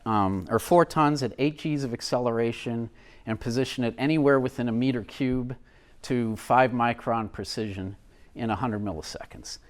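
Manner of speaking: ordinary delivery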